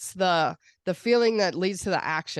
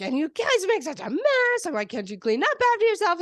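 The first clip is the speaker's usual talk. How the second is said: hammed-up tantrum, higher and louder; adult temper tantrum; 12 semitones higher, 4 dB louder than usual. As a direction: scold the kids in a mock fit. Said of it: "pitching an adult temper tantrum".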